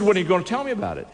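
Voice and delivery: fast, high, pushy question; impatient CEO. Retold or imitated. CEO pressing the preacher, fast and high. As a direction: rush him for an answer.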